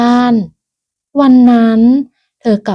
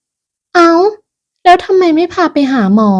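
higher, mildly reproachful surprise; startled questioning; 8 semitones higher, slower than usual. Acted. Daughter learning her mother is ill, surprised and questioning. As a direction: ask it high, as if taken aback.

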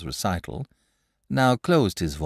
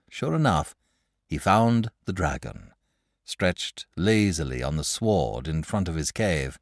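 ordinary delivery, nothing marked

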